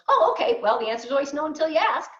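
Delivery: high, loud voice of an unbothered seatmate; imitated breezy retort. Quotes seatmate's breezy reply.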